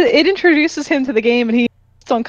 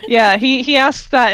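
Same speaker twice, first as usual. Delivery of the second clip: usual speaking voice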